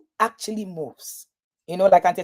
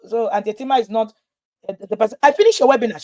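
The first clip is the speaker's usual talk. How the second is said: ordinary delivery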